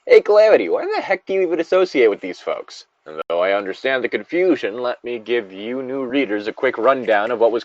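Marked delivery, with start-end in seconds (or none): 0.1-2.9 mock curious viewer asking, higher; imitating a viewer. Narrator voicing a new reader's question, lightly higher. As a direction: voice a puzzled fan, pitched up. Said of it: "you may be wondering".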